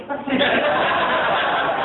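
a high, airy burst of laughter; delighted unvoiced laugh; real laugh. Real, not acted. Laughing at child support refund.

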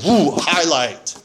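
slow delighted laugh; gleeful chuckle. Real, not acted. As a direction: laugh it out, thrilled. Excited find in the book.

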